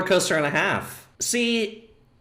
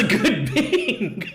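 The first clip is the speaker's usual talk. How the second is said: laughing. Real, not acted. Joking that the older bot was good, laughing. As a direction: start the joke, then crack up.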